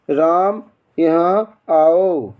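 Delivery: slow calling with the vowel of आओ stretched long; calling out, prolonged. Shown as a demonstration. Teacher demonstrating a pluta vowel to students, calling out slowly. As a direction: call राम from afar, stretching आओ out. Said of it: "तो इसका example है".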